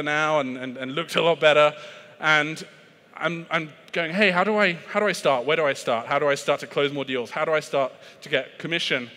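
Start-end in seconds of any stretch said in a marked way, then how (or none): none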